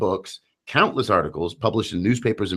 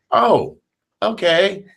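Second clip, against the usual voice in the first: high cheerful father; 12 semitones higher, 5 dB louder, much slower than usual. Retold or imitated. Father's reply, quoted.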